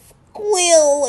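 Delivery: high, loud squealed word; cringing squeal. Real, not acted. Recapper uncomfortable with the couch scene, cringing squeal. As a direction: squeal it out in discomfort.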